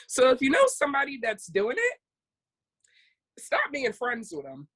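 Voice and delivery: ordinary talk, nothing marked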